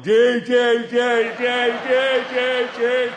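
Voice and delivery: street-corner idiot yelling the letter. Retold or imitated. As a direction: bark the letter like a fool. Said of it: "some idiot ... going".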